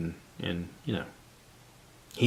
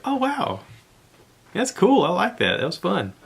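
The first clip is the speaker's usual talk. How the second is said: high-pitched imagined "wow" response; pretend impressed reaction; 11 semitones higher than usual. Imagined listener reacting to the podcast, impressed and high-pitched.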